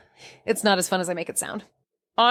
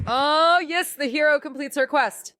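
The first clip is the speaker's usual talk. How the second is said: lightly mock-epic narrator, pitched up high; mock heroic; 8 semitones higher than usual. Proud of finding a big roach, mock-heroic voice pitched up high. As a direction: announce the quest's end like a mock game hero. Triumphant find of a monster roach.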